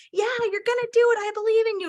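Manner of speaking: encouraging. Retold or imitated. Husband encouraging over phone.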